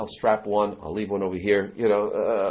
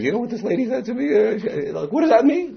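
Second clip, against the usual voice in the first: wife's puzzled voice; 13 semitones higher, 5 dB louder than usual. Retold or imitated. Wife retelling to husband.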